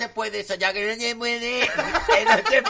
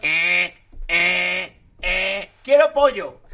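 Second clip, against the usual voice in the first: 1 tag: drawled royal demand; 5 dB louder, much slower than usual. Pretend. Reina pide pollo.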